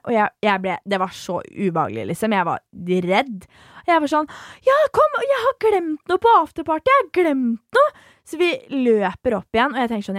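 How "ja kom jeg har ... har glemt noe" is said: fake cheerful excuse.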